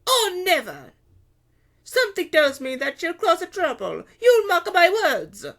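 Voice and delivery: very high, loud market woman; Carlotta, suspicious. A narrator's reading. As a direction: warn darkly in a high, loud voice. Carlotta voiced in the story.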